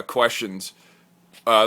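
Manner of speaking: ordinary delivery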